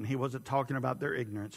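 normal talking voice

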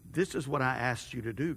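a quiet, tender God offering rest; God's voice. A narrator's reading. Narrator voices God gently.

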